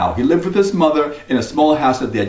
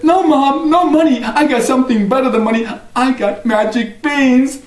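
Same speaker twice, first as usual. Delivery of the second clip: put-on high, proud boy; 9 semitones higher than usual. Jack proudly tells his mother about the beans, high. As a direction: announce it proudly.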